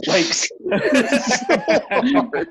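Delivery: laughing hard, voice jumping high; cracking up at the memory; laughter breaking through the words. Real, not acted. Laughing at friend's crash.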